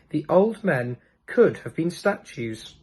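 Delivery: ordinary delivery, nothing marked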